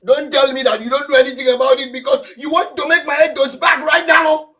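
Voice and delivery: high, fast furious scolding; angry yelling. Acted. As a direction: shout him down in rage. Husband raging at wife Margaret.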